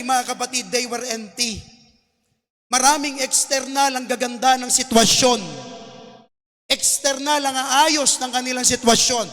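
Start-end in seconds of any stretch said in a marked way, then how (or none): 2.7-9.3 louder, rising sermon climax over music; preaching at full force. Acted. Pastor urging the congregation, building passionate preaching. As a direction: stir the church toward the altar call.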